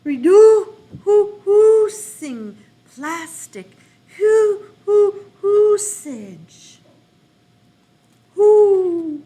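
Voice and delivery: loud high hoots breaking into the reading; owl voice. Shown as a demonstration. Teacher reading like an owl, hooting loud and high. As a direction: hoot like an owl between words. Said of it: "like an owl".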